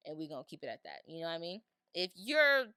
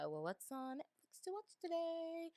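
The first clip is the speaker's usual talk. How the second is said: idle browsing; 10 semitones higher, 7 dB quieter than usual. Retold or imitated. Acting out idle browsing of Netflix.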